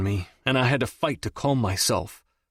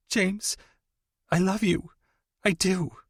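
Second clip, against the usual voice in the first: much higher, fragile; tearful confession; 10 semitones higher than usual. A narrator's reading. Audrey confession.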